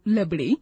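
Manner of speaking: लबड़ी, with l in place of r; mispronounced rabri. Shown as a demonstration. Teacher showing a common mistake, लबड़ी with l. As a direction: swap the r for l in लबड़ी. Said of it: "अशुद्ध शब्द बोलते हैं".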